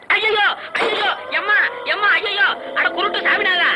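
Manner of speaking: high-pitched terrified yelping; panicked wailing. Acted. Rider flees the ghost, yelping in panic. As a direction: cry out in comic terror.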